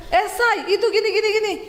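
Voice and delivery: high-pitched insistent nagging; high morning chatter. Retold or imitated. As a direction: burst out high at a startled spouse. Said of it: "Dia teriak. Aku kaget loh".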